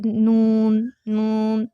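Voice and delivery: نون with the sound pushed into the nose; nasal noon. Shown as a demonstration. Teacher demonstrating the nasal نون mistake. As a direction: say نون nasally as the wrong example. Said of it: "ناک میں آواز جا رہی ہے".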